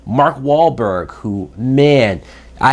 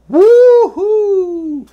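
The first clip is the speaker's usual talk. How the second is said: much higher, louder sarcastic whoop; hammed-up fake celebration; mock cheer; 6 dB louder than usual. Mocking cheer for Baywatch.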